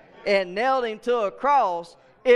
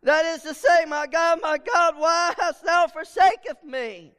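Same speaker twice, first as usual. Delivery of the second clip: higher, louder cry quoting Jesus, a touch held back; 8 semitones higher, 4 dB louder than usual. Retold or imitated. Preacher voicing Jesus crying to God, a mild loud cry. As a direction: cry out as from the cross. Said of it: "Jesus cried with a loud voice".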